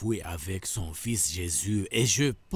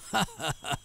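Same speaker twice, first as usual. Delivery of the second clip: short gleeful villain laugh; quick triumphant snicker; evil laugh; 4 semitones higher, 4 dB quieter than usual. Acted. Villain delighted, plan working. (rire triomphant et bref)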